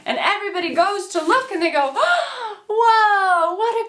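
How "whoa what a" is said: gasp, then high, loud astonishment; hammed-up high-pitched wonder; amazed onlookers. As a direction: marvel like a stunned crowd. Crowd amazed at the wild exit.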